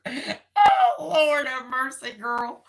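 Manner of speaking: high squeal breaking into laughter; laughing. Real, not acted. Laughing exasperation at herself, to the viewer. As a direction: laugh it out with a Lord have mercy.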